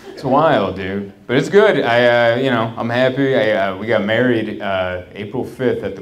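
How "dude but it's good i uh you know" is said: normal talking voice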